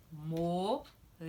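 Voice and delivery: Morango split slowly, mo-ran-go, one beat per syllable; sílabas separadas devagar; syllables clapped out. Shown as a demonstration. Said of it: "Vamos separar ela batendo palmas agora".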